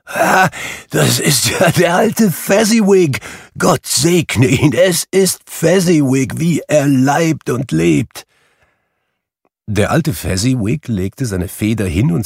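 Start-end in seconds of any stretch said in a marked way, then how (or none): 0.1-8.3 much higher, elated cry of recognition; Scrooge in great excitement. A narrator's reading. Scrooge recognising his old master Fezziwig, overjoyed and much higher. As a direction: burst out in delight at an old friend. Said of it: "rief Scrooge in großer Aufregung".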